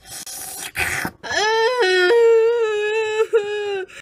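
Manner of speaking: hammed-up sobbing; theatrical sob. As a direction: wail with relish. Crying on purpose.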